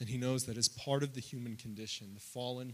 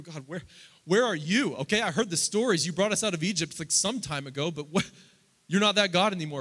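loud, higher, frustrated cry to God; a believer loudly venting at God; exasperated prayer; 7 semitones higher, 14 dB louder than usual. Acted. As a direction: demand an answer from heaven. Voicing a disillusioned prayer.

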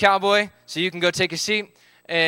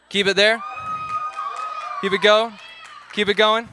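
encouraging shouts to a group; cheering them on; 4 semitones higher than usual. Real, not acted. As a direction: urge them to hold on. Cheering kids holding a pose.

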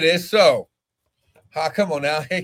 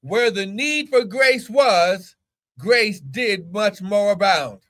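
higher, slow proclaiming; preaching; 4 semitones higher, much slower than usual. Acted. Preacher proclaiming grace to his church, slow. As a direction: proclaim it slowly, lifting the pitch.

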